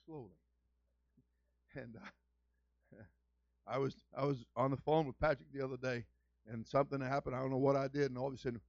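usual speaking voice

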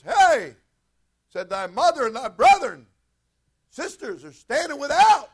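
high, loud call over a crowd; yelling out. Retold or imitated. Someone interrupting Jesus' teaching about his family, yelling out. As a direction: call out to be heard. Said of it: "yells out and says".

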